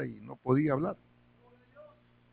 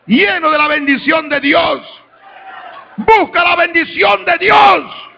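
high, loud pulpit shouting; shouted preaching; 10 semitones higher, 13 dB louder than usual. Acted. Pastor shouting blessings at the congregation, high and loud. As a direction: shout the blessing over them.

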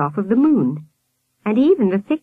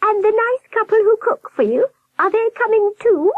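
very high warbling question; high-pitched nurse; 4 dB louder than usual. A narrator's reading. Nurse asking Sam about the cooks, high warble. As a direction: ask it in a high, warbly falsetto. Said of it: "the high-pitched voice".

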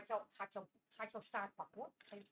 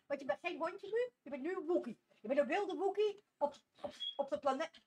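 usual speaking voice

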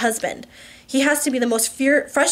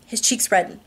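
ordinary delivery, nothing marked

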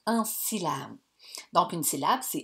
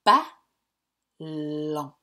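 Ballon in two slow beats, no louder; syllables tapped out; 3 semitones lower, 4 dB louder than usual. Shown as a demonstration. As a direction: chin on hand, beat out Ballon slowly. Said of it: "combien de coups je vais donner avec ma voix".

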